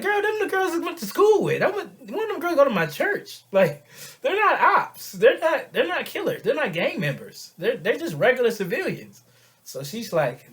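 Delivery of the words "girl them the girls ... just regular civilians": high, loud comic indignation; outraged protest. Acted. Man defending his church and school friends, outraged. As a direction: plead their innocence loudly, way up high. Acted protest against her accusation.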